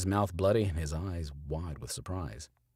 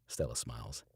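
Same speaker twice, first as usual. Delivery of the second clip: ordinary delivery, nothing marked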